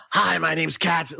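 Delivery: slow, gravelly cigarette voice; Katzy. Retold or imitated. Showing listeners the original dub voice, hammed-up gravelly rasp. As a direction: rasp it like a pack-a-day smoker. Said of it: "a very deep voice and a very gravelly voice".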